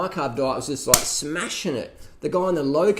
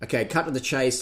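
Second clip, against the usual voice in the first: normal talking voice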